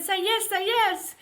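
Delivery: put-on wife urging husband to accept the baby, pleading; urgent plea. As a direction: beg it, overjoyed. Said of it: "And I said".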